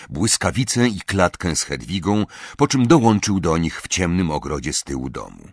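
ordinary delivery, nothing marked

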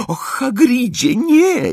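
very high, distressed moan; moaning plea. A narrator's reading. Hermione begging Hagrid to stop, high moan. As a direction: wail a pleading protest. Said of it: "jęknęła Hermiona".

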